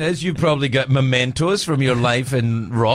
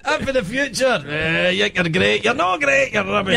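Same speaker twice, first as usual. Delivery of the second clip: much higher, hammed-up scolding voice; cranky future man; 12 semitones higher than usual. Playing the future guy yelling at a neighbour, hammed-up. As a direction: scold like a grumpy time traveller. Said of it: "yells at me".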